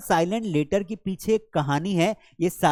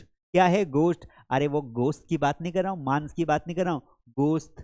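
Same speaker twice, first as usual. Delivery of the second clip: usual speaking voice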